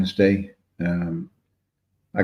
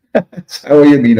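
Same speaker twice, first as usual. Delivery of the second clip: laughing; 6 semitones higher, 6 dB louder, slower than usual. Real, not acted. Laughing at a drunk joke in chat.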